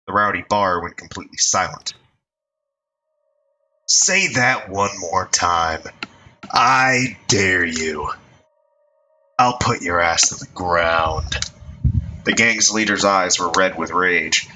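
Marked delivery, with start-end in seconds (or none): 3.9-11.4 deliberate, dangerous gang boss; slow menacing threat. Acted.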